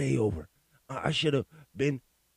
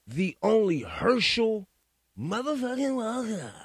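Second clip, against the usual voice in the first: a drawn-out, higher mock introduction; mock hype announcer; 6 semitones higher than usual. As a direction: build the name up like a ring announcer. Hyping up the clip's speaker.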